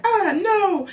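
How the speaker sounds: high-pitched mock squeal of refusal; mock distressed cry. Reviewer imitates the heroine refusing, in a high mock cry. Acted reaction of film character.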